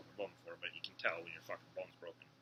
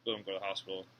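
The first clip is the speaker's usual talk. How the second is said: normal talking voice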